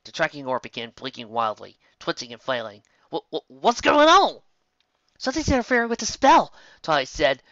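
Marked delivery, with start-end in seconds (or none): 3.1-4.4 startled stammer. A narrator's reading. Tracking orb suddenly flails wildly. 5.2-6.5 much higher and louder, alarmed; panicking. A narrator's reading. Twilight panicking as her spell is disrupted, loud and high.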